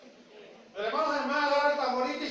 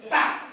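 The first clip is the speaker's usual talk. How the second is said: one loud, sudden "pa"; explosive punch sound effect; imitated punch; 7 dB louder than usual.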